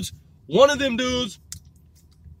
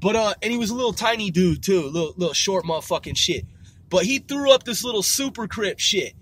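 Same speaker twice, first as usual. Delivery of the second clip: ordinary talk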